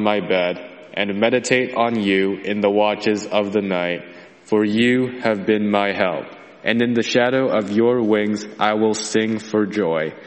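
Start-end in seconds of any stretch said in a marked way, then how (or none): none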